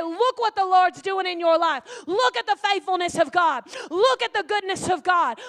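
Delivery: loud, joyful urging; loud encouraging friends rejoicing. Acted. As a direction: cheer them on loudly. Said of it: "people that say".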